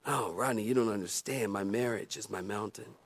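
mock a worried church member pleading; imitating congregant. Voicing a troubled congregant.

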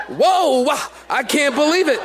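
stunned disbelief. Retold or imitated. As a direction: gasp at it with a laugh. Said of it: "and I was like".

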